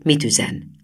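usual speaking voice

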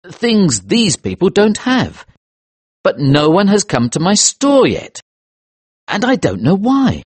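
high, emphatic protest; aggrieved, insistent complaint; indignant. Acted. Indignant narrator pleads case to chiefs.

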